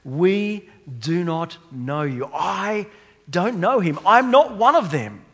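loud, rising denial; emphatic denial. Retold or imitated. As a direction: disown him completely. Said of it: "Peter is saying the same thing".

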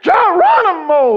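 jumping off the platform, a long loud yell; retold battle yell. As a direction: yell it as you leap off the catwalk. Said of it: "I scream some Indian sound".

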